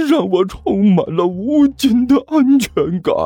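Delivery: high, slow, trembling with emotion; tearful rapture. A narrator's reading. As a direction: swoon through happy tears. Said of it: "一滴泪水".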